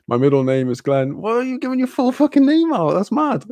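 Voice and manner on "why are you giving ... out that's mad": high-pitched comic incredulity; mock disbelief. Streamer reacting to a viewer giving their full name, mock disbelief. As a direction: squeak it out in pretend shock.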